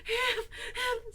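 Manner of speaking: high, panting squeak; breathless. A narrator's reading. Piglet arrives after running, high breathless panting. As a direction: gasp it out, hardly able to speak. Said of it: "breathing so hard that he could hardly speak".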